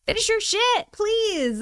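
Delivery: high, whiny begging; exasperated plea. Acted. Streamer begging absent contractor to finish, exasperated. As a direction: plead in a high, fed-up voice.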